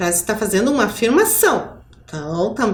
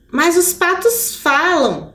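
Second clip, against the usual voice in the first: 1 almost crying; 6 semitones higher, slower than usual. A narrator's reading. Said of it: "disse Milton quase chorando".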